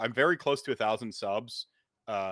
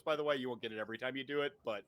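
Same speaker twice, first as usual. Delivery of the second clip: ordinary delivery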